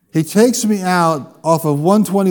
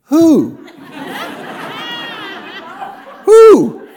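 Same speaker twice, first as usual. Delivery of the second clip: owl hoot. Retold or imitated. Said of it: "And with every who".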